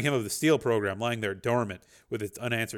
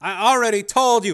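higher, louder tense; strained defence; defensive Dev; 11 semitones higher, 11 dB louder than usual. A narrator's reading. Dev stonewalling the program, tense.

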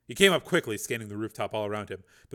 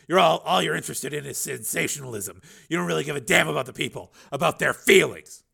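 bitter accusation; 5 semitones higher, 8 dB louder than usual. Acted. Steele accusing reporter.

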